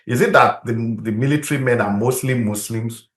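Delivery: ordinary talk, nothing marked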